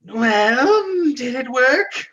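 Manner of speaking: high, loud croaking hag; old hag pleased with herself. Acted. Narrator voices the hag.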